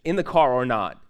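worked up and amused, pushing the point; loud, laughing exasperation; excited. Real, not acted.

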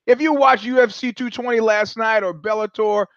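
ordinary talk, nothing marked

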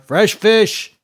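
high, loud hawker's call; yell. A narrator's reading. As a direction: holler it to the market. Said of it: "yelled Temer".

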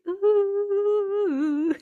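high laughing ooh; can't stop laughing; real laughter. Real, not acted. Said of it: "I can't stop laughing".

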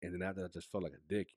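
usual speaking voice

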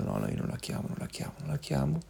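stubborn mutter. Retold or imitated. Telling himself he won't call her, soft mutter. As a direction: mutter it stubbornly under your breath.

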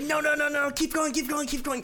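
high, urgent keep-going plea; eager client. Retold or imitated. Client urging the masseuse on, high and urgent. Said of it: "And he's like".

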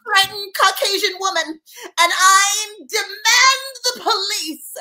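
prim frightened English lady; British accent. Pretend. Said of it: "like, the Queen's voice?".

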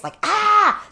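sudden high yelped ah; jump-scare shriek to frighten; mock scream. Mimicking a flasher's scare, high sudden shriek.

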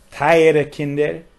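slow, loud, trembling "Teure Kinder"; near tears. Retold or imitated. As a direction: stretch out "Teure Kinder" as if about to cry. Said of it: "he almost started crying".

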